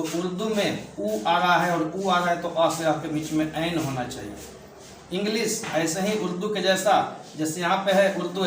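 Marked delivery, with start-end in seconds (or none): 3.5-5.2 slow quiet conclusion. Shown as a demonstration. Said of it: "teacher gives the answer".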